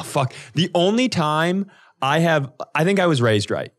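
normal talking voice

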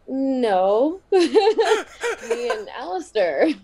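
laughing through the correction. Real, not acted. Telling her mentor who she is marrying, laughing. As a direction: giggle out the answer, stretching it slowly.

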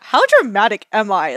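loud, laughing at yourself; amused self-mockery. Traveler mocking her own near-tears at check-in, amused.

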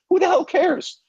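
strained squeal of disbelief; exasperated. Real, not acted. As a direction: scoff in disbelief. Exasperated rant about media respect.

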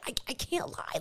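stammering retold whisper; whispered protest. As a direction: whisper it helplessly. Secretly signalling back to John.

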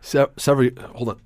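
ordinary talk, nothing marked

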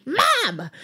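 high exasperated cry of mom; grumpy daughter blowing up at her mom, high exasperated yell; exasperated outburst. Retold or imitated. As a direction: snap the word like a grumpy teen. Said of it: "I just blew up on her".

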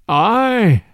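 rueful, drawn-out admission; sorrowful. A narrator's reading. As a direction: confess with mild mock sorrow. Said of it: "more sorrowfully than before".

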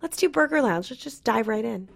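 put-on high-pitched, light child voice; imitated little boy. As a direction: pipe up like a small kid making a decision. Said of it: "He goes".

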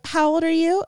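high-pitched quoted question; imitated great aunt. Great aunt asks her niece's age, imitated in high voice. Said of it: "And she was like".

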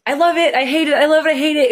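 high, loud mock back-and-forth of love and hate; imitating a teary freshman's swings, high and loud; emotional swings. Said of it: "It's very emotional".

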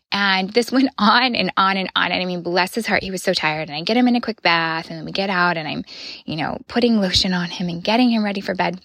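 ordinary talk

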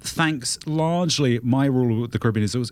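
ordinary talk, nothing marked

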